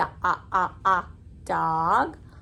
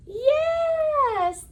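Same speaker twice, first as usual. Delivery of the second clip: excited praise. Real, not acted. Praising a child's answer.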